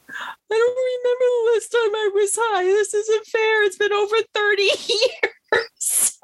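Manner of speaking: very high, squeaky mock-crying complaint; hammed-up whimper at the top of the voice; mock tearful whine. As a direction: wail it like a drugged-up kid, breaking into laughter. Acts out drugged whining to friend.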